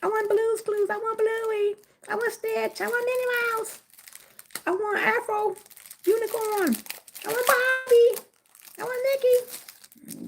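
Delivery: put-on high child voice listing every toy; greedy kid pleading. Kid at the Easter egg hunt begging mommy, high whiny voice. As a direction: whine for each toy like a little kid. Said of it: "the kid gonna be like".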